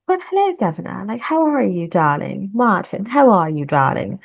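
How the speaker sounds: put-on playful British accent, swooping high at the start; hammed-up British greeting with a lilting pitch; imperfect British accent. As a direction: put on a not-quite-there British accent. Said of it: "my British accent is not, like, all the way".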